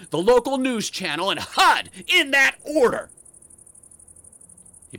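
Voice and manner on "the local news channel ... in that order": loud, rising threat; letting him have it. Retold or imitated. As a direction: build to an angry, high-pitched ultimatum. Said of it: "Oh, I let him have it".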